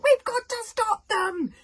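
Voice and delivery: high, loud, slow urgent yell; boy yelling to stop the funeral. A narrator's reading. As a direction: yell to rally everyone. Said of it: "yelled Hiccup".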